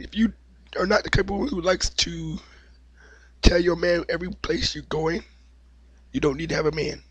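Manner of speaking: ordinary delivery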